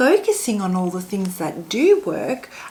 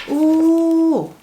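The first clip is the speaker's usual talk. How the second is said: high, loud delighted ooh; 9 semitones higher, 7 dB louder than usual. Real, not acted. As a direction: gasp with delight at the card. Reacts as the card is revealed.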